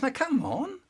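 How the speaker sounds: a hint of a helpful big brother; brother's voice. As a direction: coax him along kindly. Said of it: "But he goes".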